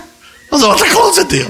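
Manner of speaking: very high, squeaky comic complaint; mock wail. Missionary robbed of a convert, high mock wail.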